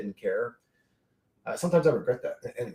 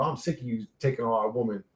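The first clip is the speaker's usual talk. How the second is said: ordinary talk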